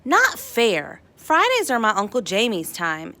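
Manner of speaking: ordinary delivery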